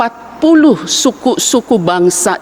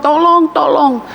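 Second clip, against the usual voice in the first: higher-pitched call of tolong, slowed; mock cry for help; 7 semitones higher, slower than usual. Man in a dry well calling for help, high mock cry. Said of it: "Dia berteriak minta tolong".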